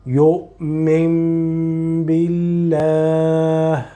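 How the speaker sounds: يؤمن بالله with noon turned to meem, slow; slow recitation demo. Shown as a demonstration. Teacher recites for student, slow careful recitation. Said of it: "You will pronounce it like here".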